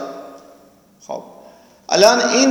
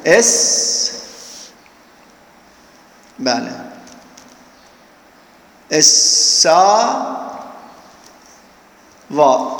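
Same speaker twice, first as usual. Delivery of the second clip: اسا drawn out slowly, pause, then وا; split slowly; much slower than usual. Shown as a demonstration. Teacher to students, splitting اساوا into slow halves. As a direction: break اساوا in two, slow and deliberate.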